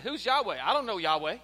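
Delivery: loud, mocking, rising dismissal; scornful Pharaoh. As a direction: sneer it out like a proud king. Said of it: "Pharaoh said".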